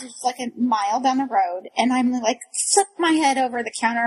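normal talking voice

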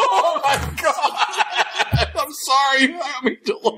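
high, breaking into real giggles; can't stop laughing at the awful news; laughing in shock. Real, not acted. Friend hearing the aunt died, laughing in shocked disbelief.

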